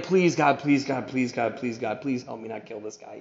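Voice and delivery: repeated plea, fading to very soft and low; muttered prayer. Retold or imitated. Driver praying not to kill the tailgater, muttered. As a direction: pray under your breath, dropping away. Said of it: "I'm praying".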